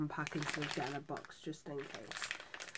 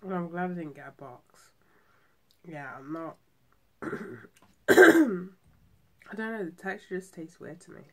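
talking between bites of sticky sweet; chewing while talking; 13 dB louder than usual. Real, not acted. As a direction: mouth partly full of mochi. Seen 1: Holds bitten pink mochi at mouth, jaw moving while talking.

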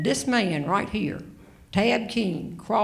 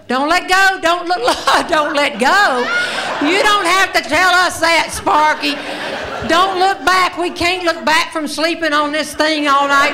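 loud, high shouted warnings; retold shouting; 6 semitones higher, 7 dB louder than usual. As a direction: call out like a guide on the cliff. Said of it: "Sparky shouting at us".

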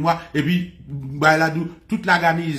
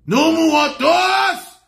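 slow, high shout of the garrison; slow battle cry, much higher and louder; rallying war cry; 11 semitones higher, 5 dB louder, much slower than usual. Acted. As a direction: bellow it like soldiers swearing to die.

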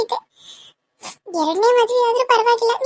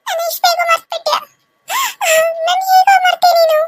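higher, shouted accusation; angry ghost shout; 6 semitones higher than usual. Acted. Ghost shouting at Kishore for forgetting her. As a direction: yell at him for forgetting.

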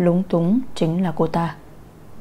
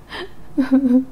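sob; 6 semitones higher than usual. A narrator's reading. Wailing after child's death.

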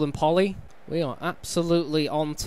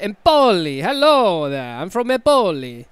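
hammed-up Italian accent, louder; mock Italian accent; 4 semitones higher, 8 dB louder than usual. Gamer mocking a local from Empoli, hammed-up Italian accent. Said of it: "you can't say it without being, like, Italian".

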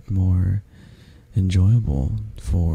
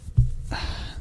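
soft, breathed-out sigh; quiet settling exhale; contented sigh; 8 dB quieter than usual. Acted. Settling into a cuddle.

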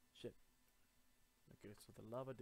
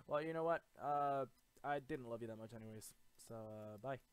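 mock breakup; 9 dB louder than usual.

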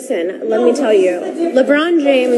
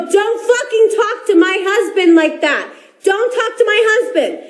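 higher insistent telling-off; retold angry warning; 7 semitones higher than usual. Wife repeating her warning to LeBron, higher insistent scold. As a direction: hammer the warning home.

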